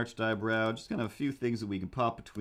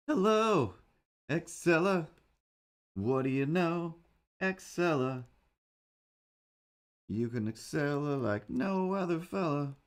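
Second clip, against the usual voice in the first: silly greeting voice; 6 semitones higher than usual. Pretend.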